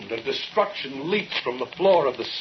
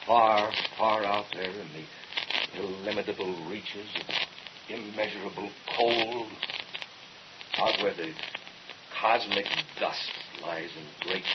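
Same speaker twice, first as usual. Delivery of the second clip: hushed and eerie; 5 semitones lower, 8 dB quieter than usual. Acted. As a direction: speak like a voice from cold empty space. Accusing voice describes cold space.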